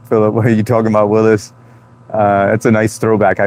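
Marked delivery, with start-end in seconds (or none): 0.1-1.5 quick, lightly voiced Arnold; a hint of the sitcom kid, rattled off fast; Arnold catchphrase. Streamer quotes Different Strokes line, fast and lightly voiced. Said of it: "Yo, Philip".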